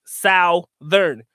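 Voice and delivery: Southern split in two, "SOW... thern"; Southern spoken as spelled, "SOU-thern", broken between syllables; Filipino pronunciation. Shown as a demonstration. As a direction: sound out Southern syllable by syllable, OW as in "how". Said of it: "They pronounce it Southern".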